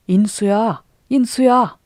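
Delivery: put-on loud, high calling shout after the boy; shouting a name. A narrator's reading. Chasing after the boy, shouting his name. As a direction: yell his name to stop him. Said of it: "하고 규성을 치며".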